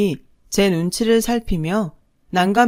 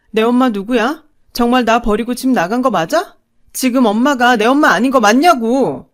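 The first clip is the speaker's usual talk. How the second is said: loud, higher demand; demanding shout; 4 semitones higher, 6 dB louder than usual. A narrator's reading. Daughter confronting her father at the bakery, demanding shout. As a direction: confront him, desperate.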